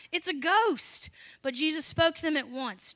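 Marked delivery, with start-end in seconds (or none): 0.1-1.1 mildly raised, drawn-out cry of fear; fearful cry. A narrator's reading. Disciples seeing Jesus walk on water, mild fearful cry. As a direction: read it as a slow, mildly frightened exclamation. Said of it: "In fear, they cried out".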